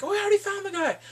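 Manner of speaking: high, loud whiny imitation; mock protest. Team wanting to leave, high mock protest.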